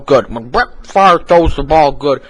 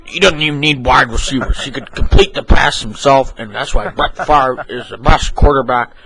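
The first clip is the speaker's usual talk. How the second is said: ordinary talk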